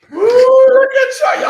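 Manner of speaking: loud, very high, drawn-out whoop; excited whoop. Real, not acted. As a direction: whoop in delight at the audience. Whooping greeting to the viewers.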